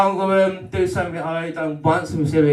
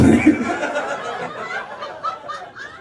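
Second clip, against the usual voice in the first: high laugh. Real, not acted. Laughs at his own joke.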